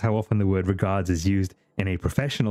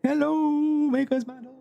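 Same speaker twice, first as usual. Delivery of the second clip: high silly voice. Acted. Greeting raider in a put-on voice.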